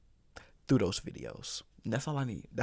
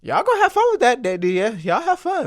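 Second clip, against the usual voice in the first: mocking send-off; 11 semitones higher, 9 dB louder than usual. Teasing family about the coaster.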